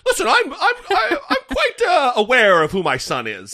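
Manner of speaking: high laughing mock dad voice; mocking Youngkin. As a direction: squeak it out through laughter.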